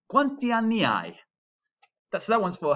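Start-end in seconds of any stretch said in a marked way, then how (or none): 0.1-1.2 "Quanti anni hai?" said clearly, starting higher; clear Italian repeat. Shown as a demonstration. Teacher repeating the phrase for students, clear. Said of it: "I'll say it again".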